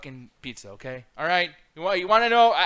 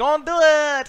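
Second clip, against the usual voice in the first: shout; 9 semitones higher, 11 dB louder, slower than usual. Real, not acted. Echoes warning shout.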